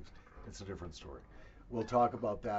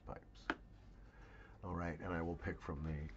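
whisper; 5 semitones lower, 9 dB quieter than usual. Real, not acted. Trailing aside after the sentence.

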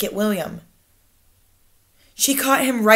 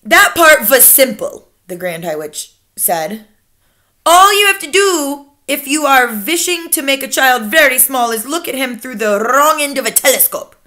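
imperious Grand High Witch; 4 semitones higher, 5 dB louder than usual. Acted. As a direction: lecture your followers with icy authority.

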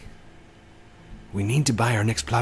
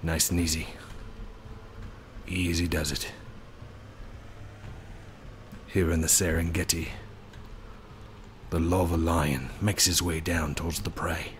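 nature documentary narrator; 4 semitones lower, 9 dB quieter than usual. Acted. Nature documentary narration about the lion.

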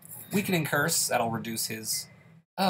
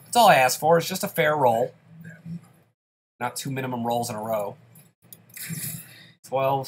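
usual speaking voice

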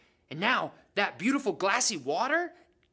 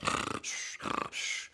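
a soft sniffing snore; mock snore; 11 dB quieter than usual. Shown as a demonstration. Snore for the kids, soft sniff. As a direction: imitate sleeping Jesus snoring gently. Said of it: "That's how Jesus snored".

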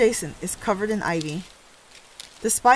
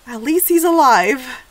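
louder, higher burst of relief; relieved exclamation; 4 semitones higher, 8 dB louder than usual. Real, not acted. Relieved reaction to the fall.

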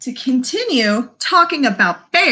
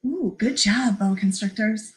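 normal talking voice

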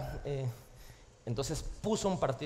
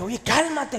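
annoyed calm down; 6 semitones higher, 5 dB louder, slower than usual. Retold or imitated. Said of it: "y ya le dice".

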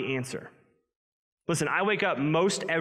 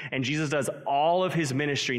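usual speaking voice

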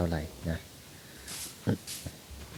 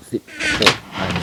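surprised; 6 dB louder than usual. Real, not acted. Counting rice seeds, pleased surprise.

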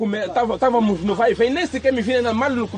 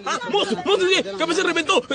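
higher, quick, laughing mock plea; mock woman's whiny complaint; mocking her whine; 8 semitones higher than usual. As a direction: mimic her pleading, laughing. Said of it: "Ela tá dizendo".

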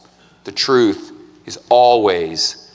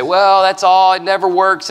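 mock skeptic; 8 semitones higher, 4 dB louder than usual. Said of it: "You say".

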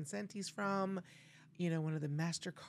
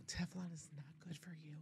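whispering; 12 dB quieter than usual. Real, not acted. Aside warning to listeners.

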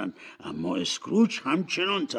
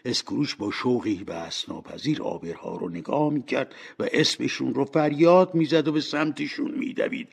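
ordinary delivery

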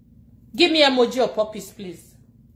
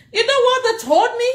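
high, louder question through laughter; laughing disbelief; 10 semitones higher, 5 dB louder than usual. Real, not acted. Telling about the housing email, laughing and much higher.

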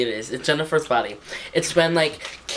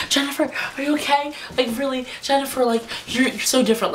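higher and quick, anxious friend checking in; worried friend; 9 semitones higher, faster than usual. Retold or imitated. Friend anxiously asking Jennifer if she's okay, high and quick. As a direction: rush the concern at a friend who seems off. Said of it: "She's like".

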